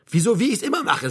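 a touch of rising anger; getting angry. A narrator's reading. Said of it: "der nun doch wütend wurde".

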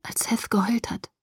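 soft frightened whisper. Acted. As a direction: still whispering, anxious. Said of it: "flüsterte ich wieder".

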